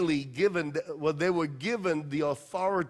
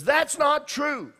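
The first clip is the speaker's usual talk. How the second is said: put-on high, drawn-out objection from a child; little Johnny; 8 semitones higher, 3 dB louder, slower than usual. Child speaking up against the Sunday school teacher, high-pitched protest. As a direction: pipe up slowly like a contrary kid. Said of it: "Johnny speaks up".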